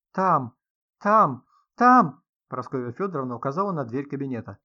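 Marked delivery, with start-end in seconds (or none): 0.1-2.2 louder, higher panicked repeats; frantic, overwrought cry. A narrator's reading. Writer points to the study door, frantic. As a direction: cry out in panic, each word rising in force. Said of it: "с надрывом".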